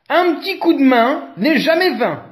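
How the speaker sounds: high, loud, triumphant thanks; joyful elephant triumph; trumpeting. A narrator's reading. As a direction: trumpet your thanks from above. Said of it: "trompette Éléphant".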